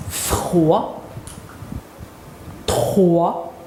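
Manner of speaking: one syllable at a time, long pauses between; model syllables. Shown as a demonstration. Teacher repeats syllables for pupils, isolated and carefully drawn out. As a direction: sound out each syllable deliberately for repetition. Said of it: "Je répète".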